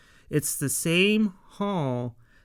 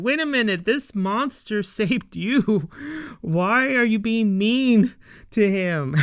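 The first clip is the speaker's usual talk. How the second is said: mock indignant; 7 semitones higher, 4 dB louder than usual. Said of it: "I'm like".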